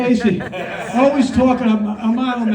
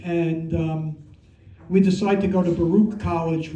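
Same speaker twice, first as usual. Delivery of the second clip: usual speaking voice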